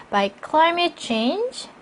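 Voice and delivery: slow, high phrase, stopping after "change"; break at comma. Shown as a demonstration. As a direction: lift "climate change", then rest. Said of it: "쉼표니까 쉬시고요". ("change" 뒤에서 끊어 읽기)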